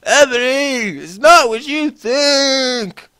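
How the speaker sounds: high, slow, drawn-out wail; sad desperate scream. A narrator's reading. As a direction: beg her with a breaking cry. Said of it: "I think that's better".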